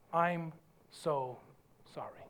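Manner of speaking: low, hushed apology with pauses; softly, one word at a time; humble apology. Shown as a demonstration. Preacher shows how humility sounds, hushed and sincere. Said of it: "What does humility sound like?".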